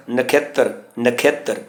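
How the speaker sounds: ਨਖੇਤਰ softly, as nakh-yet-tar; half-y pronunciation. Shown as a demonstration. Teacher modelling the subscript letters, soft half-y pronunciation. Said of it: "ਖੱਖੇ ਥੱਲੇ ਯਈਆ ਵੀ ਹੈ".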